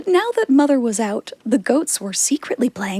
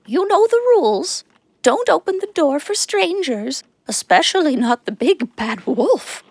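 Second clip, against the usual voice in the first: goat mother warning; 5 semitones higher than usual. A narrator's reading. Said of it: "she said to the seven little goats".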